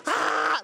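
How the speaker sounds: very high mock shriek of terror; imitated girls' scream. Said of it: "The girls are like".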